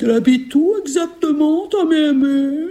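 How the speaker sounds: high, wheedling ogress question. Acted. Ogress asking where grandmother lives, high wheedling voice. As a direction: coax the answer out of the child.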